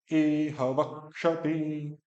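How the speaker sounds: इह वक्षति very slow with a long इह; slow recitation. Shown as a demonstration. Teacher recites for the student to repeat. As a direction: chant इह वक्षति slowly. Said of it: "बोलो".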